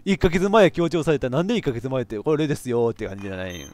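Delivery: normal talking voice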